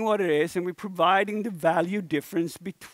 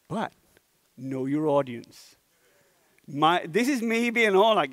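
normal talking voice

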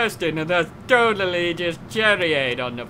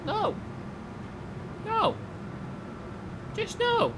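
high mock voice; 11 dB quieter than usual. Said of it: "And he was like".

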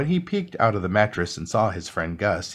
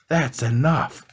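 raised, higher story-voice roar; mild roar; 4 semitones higher, slower than usual. A narrator's reading. Gus pointing his finger at Mouse, mild roar. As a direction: roar it lightly, storybook style. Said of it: "he pointed his finger at Mouse and roared".

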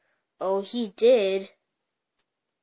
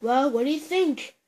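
usual speaking voice

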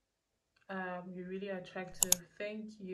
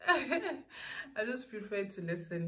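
giggly; 4 semitones higher, slower than usual. Real, not acted. Giggling while answering Q&A.

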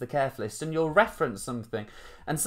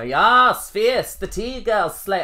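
loud, high-pitched mock hype; slangy hype impression; 9 semitones higher, 10 dB louder, much slower than usual. Host mimicking what viewers might expect, loud mock-hype voice. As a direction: shout it high, drawing out the slang. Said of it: "expecting me to be a bit, bit like".

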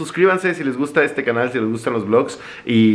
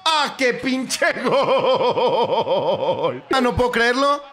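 high, slow laughing shock; laughing in disbelief; 9 semitones higher, much slower than usual. Real, not acted. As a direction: laugh through a stunning goal. Gol del Barcelona. (risa incrédula)

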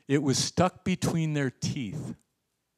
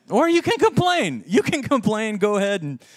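high, loud sarcastic invitation; hammed-up, high-pitched exasperated yell; mock outburst; 9 semitones higher, 8 dB louder than usual. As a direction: shout it like a fed-up preacher egging them on.

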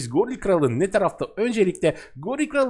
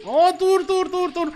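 panicked cry; 9 semitones higher, slower than usual. Real, not acted. Fleeing gorilla king in panic.

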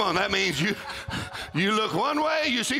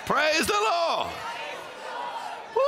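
shouted praise; 8 semitones higher than usual. Acted. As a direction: let it ring out to the congregation. Preacher builds call and response.

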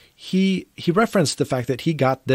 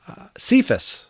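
joking; 11 semitones higher than usual. Pretend. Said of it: "No, I'm kidding".

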